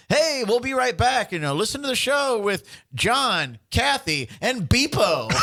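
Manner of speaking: high, slow, hyped-up morning show host; radio DJ voice. Retold or imitated. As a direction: announce it like a cheesy morning DJ. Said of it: "It's just like".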